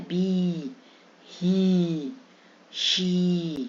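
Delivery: बी, ही, शी each held on a long "ee"; drawn-out ee on बी, ही, शी. Shown as a demonstration. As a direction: stretch the vowel in बी, ही, शी. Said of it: "ई की बड़ी ई में कब आती है".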